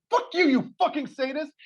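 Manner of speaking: fake-furious insult, hammed up; imitating an outraged retort; mock outrage. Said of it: "And I'm like".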